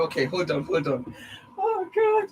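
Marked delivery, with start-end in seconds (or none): 1.6-2.3 very high exclamation; squealed oh God. Real, not acted. Vlogger delighted by her viewers, very high squeal.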